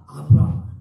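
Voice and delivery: repeated call. Acted. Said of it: "두 번 부르십니다".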